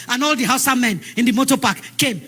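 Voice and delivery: usual speaking voice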